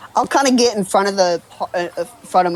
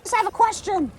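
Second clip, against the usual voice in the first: high, squeaky nervous goblin; 13 semitones higher, 4 dB quieter than usual. Acted. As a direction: pitch way up, a timid little goblin. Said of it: "your goblin voice".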